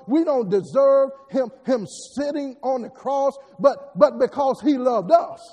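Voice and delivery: loud, emphatic preacher's cadence; impassioned sermon, punching the key words; forceful preaching. Real, not acted. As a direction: drive each phrase home to the congregation.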